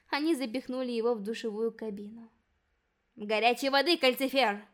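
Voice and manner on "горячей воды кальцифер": loud, grim puffed order; grim puffing order. A narrator's reading. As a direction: puff it out grimly. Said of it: "мрачно пропыхтела Софи".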